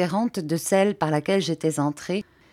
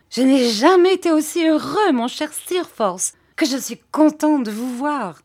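high-pitched, effusive greeting; overjoyed; 9 semitones higher, 5 dB louder than usual. A narrator's reading. David greets his long-lost friend Steerforth, overjoyed. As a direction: pour out joy at seeing a dear friend.

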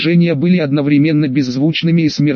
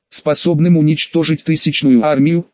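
plain reading voice

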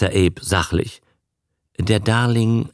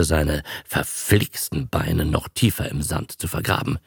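ordinary delivery, nothing marked

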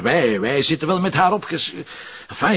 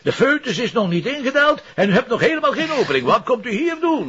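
higher, snooty mock doctor voice; mocking the young doctor; 9 semitones higher than usual. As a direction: ape the smug young doctor talking down to her. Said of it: "hoe die snottaap tegen u bezig was". (spottende imitatie van een verwaande jonge dokter, hoger)

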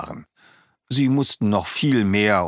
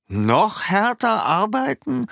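much higher, slow, unbelieving; incredulous echo. A narrator's reading. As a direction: repeat it as if it were absurd. Schwalbe echoes incredulously.

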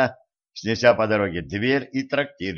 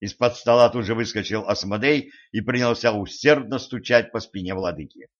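ordinary delivery, nothing marked

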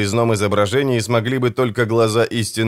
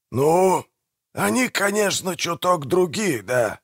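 high, softened character voice, conceding a little; hesitant concession; 7 semitones higher, 3 dB quieter, slower than usual. Acted. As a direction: grant the point reluctantly, pitched up and softer. Manfred half concedes the point.

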